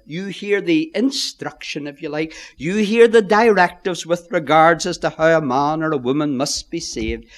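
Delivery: ordinary talk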